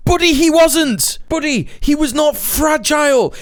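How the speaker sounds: very high-pitched, shouted contradiction; exasperated outburst. Real, not acted. Streamer contradicts commentator calling a man fragile, real exasperated outburst. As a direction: cut in, yelling up high in disbelief. Argues back at the clip.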